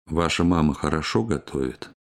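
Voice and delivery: ordinary delivery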